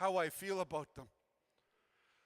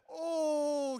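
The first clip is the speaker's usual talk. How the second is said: bright, louder high "Oh" of delight; light up at hearing the name; happy oh; 5 semitones higher, 4 dB louder than usual. Retold or imitated. Woman suddenly remembering Justin, a touch of happy recognition. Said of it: "all happy".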